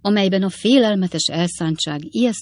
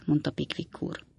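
plain reading voice

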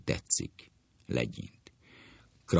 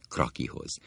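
usual speaking voice

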